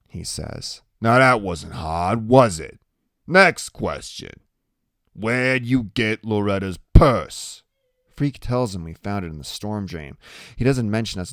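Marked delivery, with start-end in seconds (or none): 1.0-7.6 slow, loud biker-boss questioning; interrogating Iggy. A narrator's reading. Iggy questioning Freak about the purse, slow and domineering. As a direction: gang boss toying with a kid, slow and forceful.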